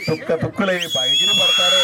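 fiery campaign shout; rally shout. Real, not acted. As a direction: roar it out as a promise to the rally. Rally speech climax.

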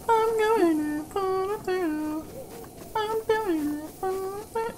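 high, slow, drawn-out hero line; mock heroic. Gamer charging enemies, slow high mock heroic voice. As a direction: ham up the charge like a movie hero.

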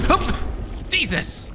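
sudden high startled cry; startled yelp. Real, not acted. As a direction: jump at the fright. Jump scare by Bendy.

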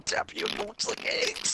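squeaky like a spray bottle. Real, not acted. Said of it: "Why do you sound like a fucking water, like a Windex bottle".

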